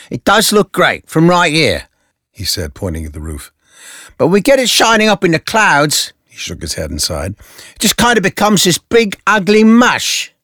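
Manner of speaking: very high, whiny complaint; whiny Gordon. Acted. Gordon complaining about the signal, very high and whiny. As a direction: sigh it out like a fed-up cop.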